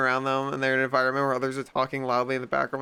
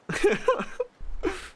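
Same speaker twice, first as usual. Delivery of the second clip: high laugh. Real, not acted. Laughing at the silly caller bit.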